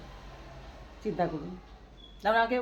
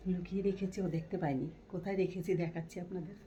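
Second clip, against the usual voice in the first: speaking softly; 6 dB quieter than usual. Real, not acted. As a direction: keep it quiet so she won't hear. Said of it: "একটু আস্তে করে কথাটা বলছি".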